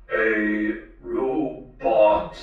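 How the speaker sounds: very slow, toneless robot question; mechanical robot voice. A narrator's reading. The talking robot questions the girl, slow mechanical voice. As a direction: stretch each syllable out like a machine. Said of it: "a mechanically timbered voice".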